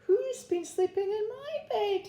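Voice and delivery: put-on very high, tiny Baby Bear voice; teeny wee voice. A narrator's reading. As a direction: squeak it like a little bear cub. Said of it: "he said in his teeny wee voice".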